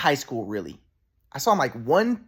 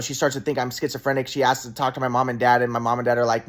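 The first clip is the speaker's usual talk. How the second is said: ordinary talk, nothing marked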